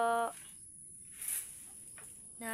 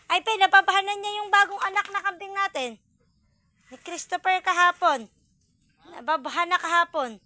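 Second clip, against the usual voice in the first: excited; 6 semitones higher, 10 dB louder than usual. Real, not acted. Excited news about the newborn goat.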